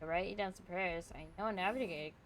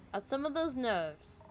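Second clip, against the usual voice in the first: ordinary talk, nothing marked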